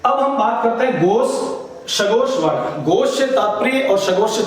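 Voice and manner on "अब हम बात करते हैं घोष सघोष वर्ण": ordinary talk, nothing marked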